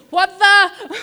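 high, loud incredulous cry; stunned outburst. Retold or imitated. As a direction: blurt out your disbelief. Boss rings narrator, stunned.

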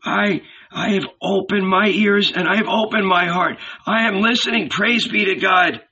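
put-on high-pitched, eager old man's voice; eager old listener. A narrator's reading. Old Ananias tells Jesus he is listening, eager. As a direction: answer high and devout, all eagerness.

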